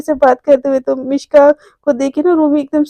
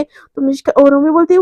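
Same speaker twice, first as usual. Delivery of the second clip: usual speaking voice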